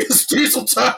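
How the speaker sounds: high, gleeful mock catchphrase; high-pitched teasing imitation; imitated laughing taunt. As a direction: crow it as if cracking yourself up. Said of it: "he's just laughing".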